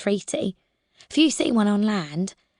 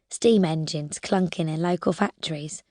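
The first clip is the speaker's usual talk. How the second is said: ordinary delivery, nothing marked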